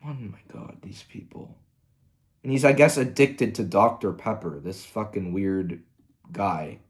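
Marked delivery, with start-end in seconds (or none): 0.0-1.6 whispered disgust. Real, not acted. Disgusted aside.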